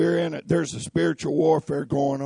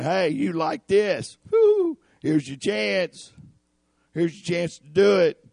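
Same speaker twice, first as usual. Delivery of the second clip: tempting devil; 6 semitones higher, 6 dB louder than usual. Acted. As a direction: dangle the bait like a sly tempter.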